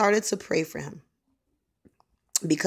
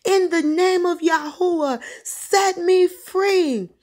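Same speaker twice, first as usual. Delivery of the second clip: high, forceful cry of deliverance; impassioned high-pitched invocation; urgent prayer command; 13 semitones higher, 4 dB louder, slower than usual. Retold or imitated. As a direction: lead a desperate prayer, stretching the last words. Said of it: "Repeat after me right now".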